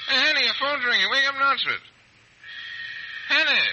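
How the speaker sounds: loud, high, tipsy calling; yelling up at a sleeping neighbour; drunken shout. Acted. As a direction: bellow to wake him.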